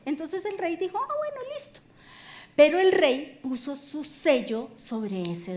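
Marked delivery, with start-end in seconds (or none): 1.0-1.8 very high, soft little voice; comically easy high-pitched agreement; mock agreeable king. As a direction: give in at once in a tiny squeaky voice. King gullibly agrees, acted.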